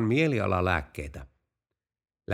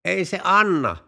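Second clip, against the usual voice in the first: higher, louder call into the distance; shouting across a field; 7 semitones higher, 4 dB louder than usual. Acted. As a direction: yell it to someone far off. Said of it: "renki huusi pellon laitaan". (renki huutaa pellon laitaan rovastille, kovaa ja korkealta)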